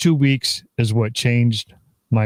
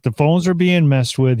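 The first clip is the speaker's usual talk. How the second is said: normal talking voice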